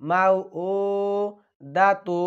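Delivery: মাউউদাতু with the উ drawn out one beat, slowly; one alif stretch. Shown as a demonstration. Teacher showing the one-alif stretch. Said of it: "এক আলিফ টানতে হবে".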